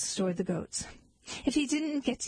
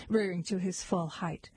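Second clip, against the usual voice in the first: ordinary delivery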